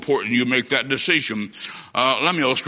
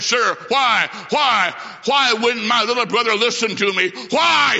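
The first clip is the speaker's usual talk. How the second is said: anguished tearful plea; 6 semitones higher than usual. Retold or imitated. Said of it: "through the tears and said".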